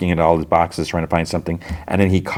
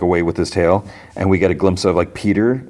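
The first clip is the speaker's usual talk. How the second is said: ordinary talk, nothing marked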